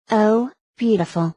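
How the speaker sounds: plain reading voice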